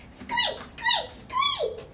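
very high tiny squeaks; mousey squeak; mouse squeaks. Pretend. Mouse in the song.

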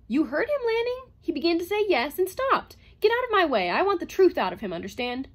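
loud, high-pitched insistence; heated, forceful outburst; angry demand. A narrator's reading. Acted quarrel, pushing past, demanding truth.

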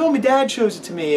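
put-on high curious little boy; kid voice. Kid asks about the drawing, in a high voice. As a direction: ask it like a small kid.

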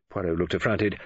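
ordinary delivery, nothing marked

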